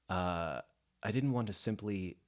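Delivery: normal talking voice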